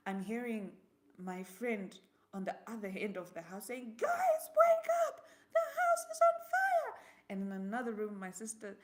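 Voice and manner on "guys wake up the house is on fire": high, loud, desperate yell; frantic yell. Retold or imitated. Friend across the house warning everyone of the fire, yelling loud and high. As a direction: scream the alarm across the house.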